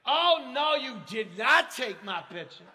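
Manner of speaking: high, shrill-free squeal of a woman caught on camera; imitating the woman protesting the photo; mock outraged woman. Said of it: "and I hear".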